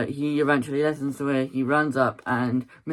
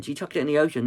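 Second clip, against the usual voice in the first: ordinary delivery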